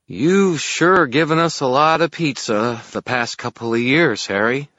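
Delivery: slow, high, loud fairy voice; hungry fairy. A narrator's reading. Fairy stares at pizza, slow and hungry. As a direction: drool it out slowly, high-pitched. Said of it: "Toot said with a swallow".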